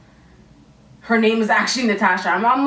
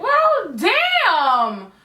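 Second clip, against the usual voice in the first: offended retort; 8 semitones higher than usual. Retold or imitated. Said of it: "And I was just like".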